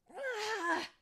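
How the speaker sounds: loud, high exasperated "aah"; frustrated yell. Acted. Tinker Bell failing to catch light, frustrated yell. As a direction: cry out in frustration. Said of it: "she yelled".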